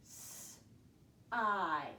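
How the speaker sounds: "S-I" as a held sss, a gap, then long eye; sounded out. Shown as a demonstration. Teacher has pupils listen for vowel, "S-I" sound by sound. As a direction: stretch and separate the two sounds of "S-I". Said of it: "listen for that vowel sound".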